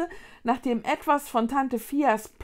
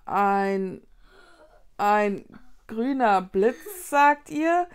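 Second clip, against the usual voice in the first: slow, stuck start with long gaps; halting question; 5 dB louder, much slower than usual. A narrator's reading. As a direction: falter word by word, then rise at the end. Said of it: "fragte Vicky stockend".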